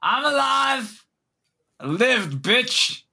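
high, slow, shouted cry of joy; triumphant shout. Acted. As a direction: yell it like you cheated death. Tiny elf shouting he lived.

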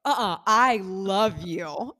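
"I love you" stretched slow with heavy weight; mock-intense, drawn-out "I love you", rising at the end; insistent fan. Re-enacting insisting to the actress.

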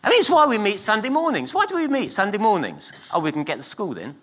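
usual speaking voice

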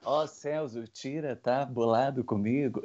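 dublagem dramática, baixa e lenta; voz de dublador. Retold or imitated. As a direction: imite um dublador antigo, devagar e baixo. Dublagem brasileira de filme. (dublador de filme brasileiro, baixo e lento)

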